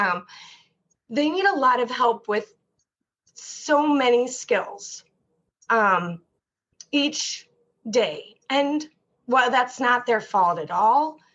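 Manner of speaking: ordinary talk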